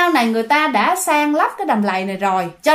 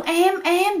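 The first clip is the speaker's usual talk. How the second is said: higher-pitched, understated calling of a partner; calling out repeatedly; 7 semitones higher than usual. A narrator's reading. Boyfriend calls his silent girlfriend, lightly voiced calling out. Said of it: "thằng Đạt nó kêu quá trời luôn".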